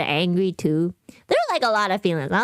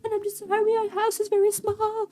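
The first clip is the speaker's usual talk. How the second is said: imitating stepmother crying; 12 semitones higher than usual. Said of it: "she was crying".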